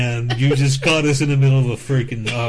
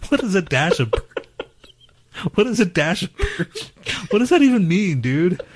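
laughing; 8 semitones higher than usual. Real, not acted. Baffled by bird shit remark.

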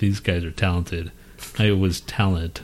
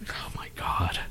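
whisper; 11 dB quieter than usual. Real, not acted. Disbelief at dry Twinkies.

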